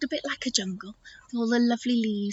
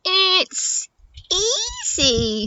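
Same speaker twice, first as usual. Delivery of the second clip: higher, bright-eyed animals boasting it's easy; storybook animal chorus; 8 semitones higher, much slower than usual. Acted. Animals reassuring Eddie about the way, confident storybook voice.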